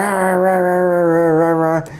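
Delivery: high frantic yapping barks; imitated frantic barking. Teller imitates the dog barking in circles, frantic high yaps. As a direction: bark wildly like a dog gone nuts.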